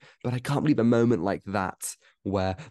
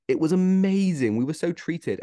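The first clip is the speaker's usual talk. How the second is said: excited; 8 semitones higher, 5 dB louder than usual. Real, not acted. Gushing about the anniversary.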